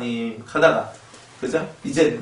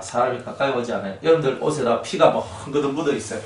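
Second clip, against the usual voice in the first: normal talking voice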